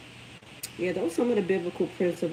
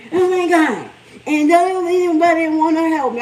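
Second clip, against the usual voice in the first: put-on much higher, louder, whiny young mother with a laugh; imitating daughter's complaint; 11 semitones higher, 12 dB louder than usual. Mom laughing as she voices her overwhelmed daughter, high and loud. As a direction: lay on the overwhelmed new mom, slow and loud.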